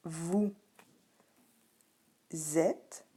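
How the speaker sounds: "Vous", long pause, then "êtes" as zèt; word-by-word "Vous êtes" with the z liaison. Shown as a demonstration. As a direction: put a z sound starting "êtes". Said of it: "il y a une liaison".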